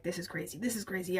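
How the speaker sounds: hushed, half-swallowed self-talk, imitated; muttered to self. Reader muttering to herself over a sad book, softly. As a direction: mutter it under your breath, mock disbelief.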